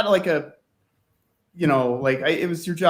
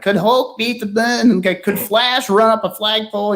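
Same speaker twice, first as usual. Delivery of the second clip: mock kid caller; 7 semitones higher than usual. Mimicking kids' calls.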